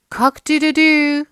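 high loud cock-a-doodle-doo; rooster crow. Acted. Rooster crows in story.

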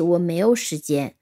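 ordinary talk, nothing marked

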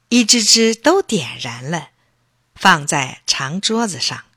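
ordinary delivery

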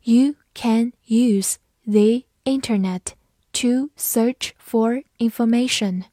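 slow, careful read-aloud, each word clear. Shown as a demonstration. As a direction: read it slowly for learners. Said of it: "好，慢读一遍。". (慢速朗读)

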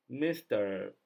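normal talking voice

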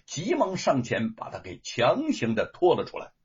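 ordinary delivery, nothing marked

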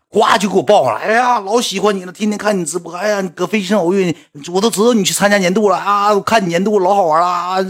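high gushing fan voice; high, gushing admirer. Retold or imitated. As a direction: mimic an overexcited fan.

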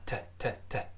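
"t" as short "tuh", the u reduced to schwa; weakened vowel. Shown as a demonstration. Teacher explaining the weakened u, short tuh. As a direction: let the vowel of "to" shrink to "uh". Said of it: "后面那个u就弱化成".

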